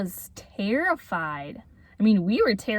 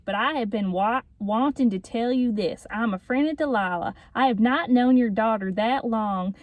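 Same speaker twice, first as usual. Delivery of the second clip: put-on Jake's Southern accent. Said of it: "I could read it and do a Southern accent".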